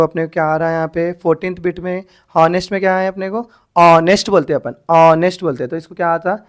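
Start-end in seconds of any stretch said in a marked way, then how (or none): 3.8-4.3 ऑनेस्ट with the H left silent. Shown as a demonstration. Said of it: "एच silent बन जा रहा". 4.9-5.4 ऑनेस्ट with no H sound, punched; silent H. Shown as a demonstration. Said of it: "एच silent बन जा रहा".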